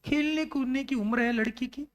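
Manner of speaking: usual speaking voice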